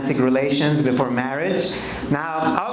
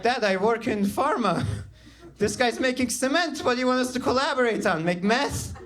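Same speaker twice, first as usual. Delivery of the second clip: higher-pitched, mildly incredulous son; mock protest; 6 semitones higher than usual. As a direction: push back at dad in mock disbelief. Acted protest to his dad.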